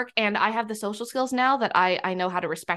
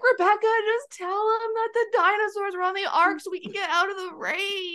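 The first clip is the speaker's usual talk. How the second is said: put-on whiny little boy; 10 semitones higher than usual. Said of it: "one of the boys standing on, like, corner three was like".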